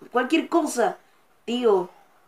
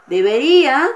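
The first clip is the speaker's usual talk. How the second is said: loud, higher insistent call; louder urging at the screen; urgent loud plea; 4 semitones higher, 9 dB louder than usual. Real, not acted. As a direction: push the word out urging the troop on.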